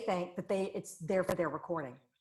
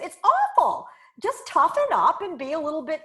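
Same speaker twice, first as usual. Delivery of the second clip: much higher and louder, fed up; exasperated outburst; 9 semitones higher, 8 dB louder than usual. Retold or imitated. Retelling John Cleese scolding thin-skinned people, in an exasperated loud voice. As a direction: blurt it out like you've had enough. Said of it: "And he said".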